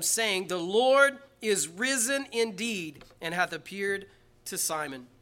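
loud, high, surging scripture reading; fervent preacher reading aloud; preaching at full force. Acted. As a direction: lift the verse up to the rafters.

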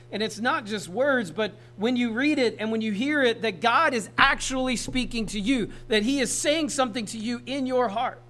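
loud, high, emphatic preaching; impassioned preaching. Real, not acted. Preacher urging listeners that God speaks to them, impassioned.

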